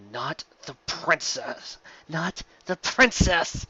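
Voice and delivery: slow, urgent hissed warning. A narrator's reading. Cannon warns her commanding officer, slow urgent hiss. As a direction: press it on your officer urgently. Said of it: "Cannon hissed".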